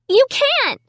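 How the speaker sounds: very high frightened cry; panicked protest. A narrator's reading. Amy protesting to the dummy, very high and scared.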